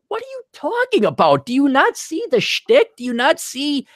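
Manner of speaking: high, incredulous exasperation; mock disbelief at their hesitation; exasperated. Retelling his reply to nervous recruits, high exasperated disbelief.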